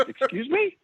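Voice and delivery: shocked excuse me. Retold or imitated. Son answering his mother's jab, high mock shock. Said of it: "I said".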